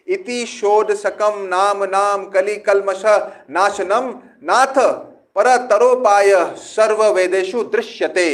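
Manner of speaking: loud, quick Sanskrit recitation; shloka recitation. Acted. Preacher quoting scripture to the audience, loud recitation. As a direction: intone the verse with force.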